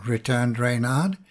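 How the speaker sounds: plain reading voice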